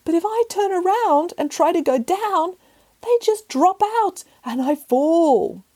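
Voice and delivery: high, slow and pitiful little cat; a frightened cat stuck up a tree; whimpering cat voice. Acted. Cat pleading to nanny, high and pitiful.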